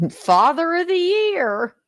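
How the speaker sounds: high, slow, dripping sarcasm; sarcastic. Real, not acted. Host mocking the father to viewers, drawn-out sarcasm. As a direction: sing it out as a mock award.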